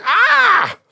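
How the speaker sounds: high, loud imitated scream; mock scream of labour pain; woman's cry. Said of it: "to a woman crying".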